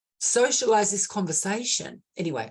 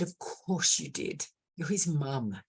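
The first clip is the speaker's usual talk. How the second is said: soft, slow and quiet, gently reassuring; a hushed, tender "of course you did"; tender reassurance; 3 semitones lower, 6 dB quieter, slower than usual. Retold or imitated. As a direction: say it softly to comfort a grieving mum. Comforting a grieving mother.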